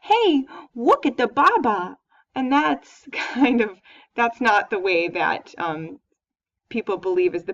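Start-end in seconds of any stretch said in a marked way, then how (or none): 0.0-2.0 put-on slow, louder baby talk saying baba. Shown as a demonstration. Teacher contrasts baby talk with motherese, slow and cooing. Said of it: "if I'm talking baby talk".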